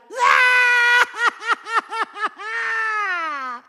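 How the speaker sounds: long, high maniacal cackle; manic cackle. Acted. Villain can't stop laughing, long manic cackle. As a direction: cackle shrilly and stretch out the end.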